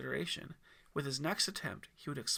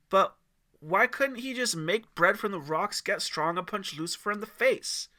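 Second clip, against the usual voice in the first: put-on kid voice; 5 semitones higher, 8 dB louder than usual. Timmy asks eagerly.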